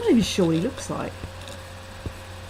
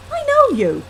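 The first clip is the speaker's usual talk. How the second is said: put-on strong Irish accent; 6 dB louder than usual. A narrator's reading. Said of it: "he says in a strong Irish accent".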